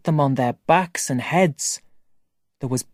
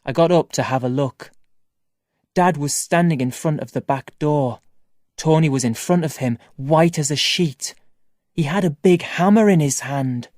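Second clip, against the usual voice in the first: ordinary delivery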